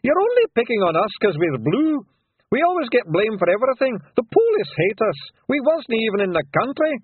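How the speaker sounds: high, loud Scots shout. A narrator's reading. Rob Anybody denying his name to the lawyers, loud shout. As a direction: bluster the lawyers away.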